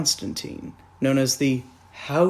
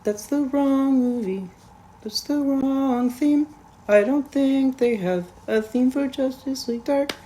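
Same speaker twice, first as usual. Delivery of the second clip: lightly raised mock voice; 8 semitones higher than usual. Reviewer mocks the movie's theme music, higher silly voice. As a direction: complain in a silly voice.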